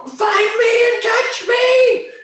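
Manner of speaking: very high, slow, loud taunting call; shrill taunting call. Acted. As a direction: sing it out high to taunt them. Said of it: "And I just go".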